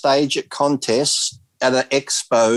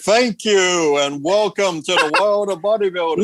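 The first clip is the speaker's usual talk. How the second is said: slow, drawn-out, higher-pitched MC welcome; [name]; 4 semitones higher than usual. Acted. Mocking an MC who can't speak, slow put-on announcer voice. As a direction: mimic a halting announcer, stretching out the words. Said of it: "I'm [name]".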